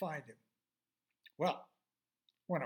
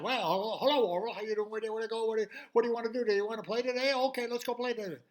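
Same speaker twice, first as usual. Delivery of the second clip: high, loud and rushed chatter; breathless fast high fish voice; fast-talking little fish; 7 semitones higher, 7 dB louder, much faster than usual. Acted. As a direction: rattle it off like an eager little fish. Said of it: "He talked so fast".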